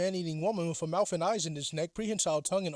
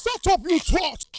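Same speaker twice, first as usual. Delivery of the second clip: much higher, louder angry snap; villain snapping; 11 semitones higher, 6 dB louder than usual. Acted. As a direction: cut her off with a snarl. Villain snaps at princess.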